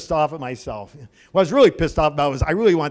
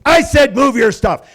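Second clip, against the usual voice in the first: slow, loud, high demand; imitated cashier raising the voice; angry repeated order; 7 semitones higher, 8 dB louder, slower than usual. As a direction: spell out the order, losing patience.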